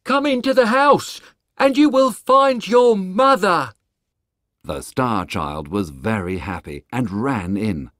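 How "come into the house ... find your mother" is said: higher, louder calling voice; calling the boy. Acted. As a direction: call him into the house. Woodcutter calls the boy.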